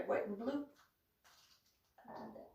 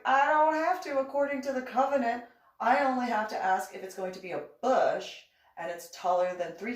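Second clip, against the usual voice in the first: indignant retort; 8 semitones higher, 8 dB louder than usual. Retold or imitated. Retold argument with neighbor.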